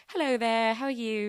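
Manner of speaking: playful amateur English accent; attempted English accent. Shown as a demonstration. Host showing off to listeners, attempted English accent. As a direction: put on a chirpy British hello. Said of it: "try to do my English accent".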